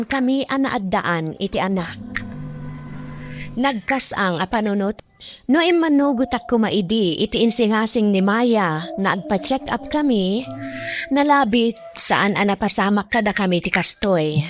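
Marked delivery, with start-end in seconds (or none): none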